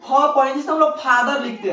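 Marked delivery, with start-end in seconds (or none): 0.0-0.1 F read as "फ", not "eff"; phonics-style "फ" with an open vowel; letter sound for F. Shown as a demonstration. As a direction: say "फ" as an open "phaw" syllable. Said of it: "एफ को क्या पढ़ना है".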